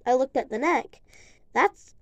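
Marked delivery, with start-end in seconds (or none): 1.5-1.9 real hiccup breaking the word. Real, not acted. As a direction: cut off by a hiccup. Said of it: "sorry, I have the hiccups really".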